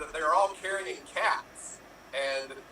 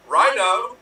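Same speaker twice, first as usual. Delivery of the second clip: loud, higher, punched answer; called-out punchline; 5 semitones higher, 7 dB louder than usual. Acted. Someone at the bar answering what the person drinks, called out. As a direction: call the answer out loudly, pitched up.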